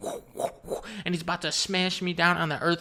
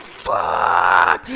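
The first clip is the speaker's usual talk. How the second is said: low, loud, drawn-out swear; hammed-up cry of doom; horrified yell; 12 semitones lower, 7 dB louder than usual. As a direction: bellow it long and deep. Horror at villain's ultimate power.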